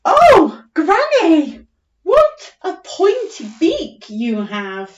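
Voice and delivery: high excited squeal then slow; squirrel voice. Acted. Teacher voicing the squirrel to Granny, squealing. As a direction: squeak it like a wide-eyed squirrel. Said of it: "The squirrel said".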